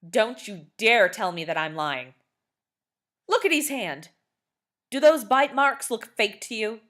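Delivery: loud, rising, indignant shouting; furious father. A narrator's reading. Father confronting neighbour over bite, loud indignant shouting. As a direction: push the accusation hard. Angry father arguing at door.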